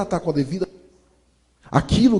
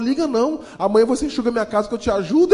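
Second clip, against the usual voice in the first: cheerful dismissal; 8 semitones higher, 8 dB louder than usual. Retold or imitated. Madame laughing it off.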